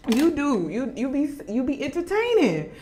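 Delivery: normal talking voice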